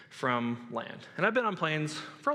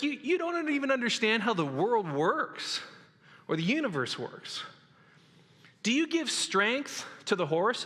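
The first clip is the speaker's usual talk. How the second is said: dumbing it down; 5 semitones higher than usual. Retold or imitated. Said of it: "dumbs it down".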